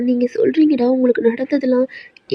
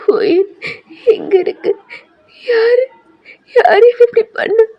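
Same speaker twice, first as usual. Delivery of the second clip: high, loud, tearful wail; crying out; 10 semitones higher, 4 dB louder, slower than usual. Acted. Girl discovers what happened, crying loudly. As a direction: sob it out in panic. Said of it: "நீங்க செம்மையா அழுகுறீங்க".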